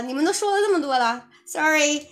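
ordinary talk, nothing marked

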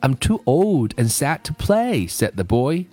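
measured storybook reading with lilting pitch; story read-aloud. A narrator's reading. Teacher reading a story for listening practice, storytelling. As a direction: read it like a gentle picture-book tale.

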